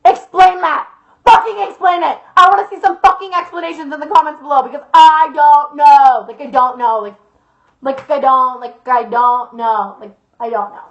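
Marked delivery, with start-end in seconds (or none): 0.0-6.2 loud, high-pitched outburst; exasperated demand. Real, not acted. Storyteller challenges viewers to explain the mystery, exasperated. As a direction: demand answers, getting louder to the last words.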